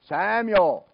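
loud, drawn-out high name call. Retold or imitated. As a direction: call across the house to a sleeper. Voice calls a second time.

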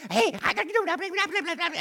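very high, whiny mock voice; annoying pest. Preacher mimicking an annoying person yammering on, very high and whiny. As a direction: needle them like a pest. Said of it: "Somebody that annoys you".

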